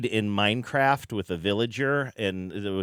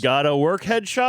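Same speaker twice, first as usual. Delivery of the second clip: louder, hyped infomercial pitch; infomercial announcer voice; 5 semitones higher, 5 dB louder than usual. Pretend. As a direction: sell it like a TV infomercial. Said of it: "Feel free to use your best infomercial announcer voice".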